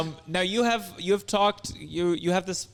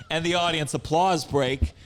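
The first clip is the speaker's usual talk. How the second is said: ordinary talk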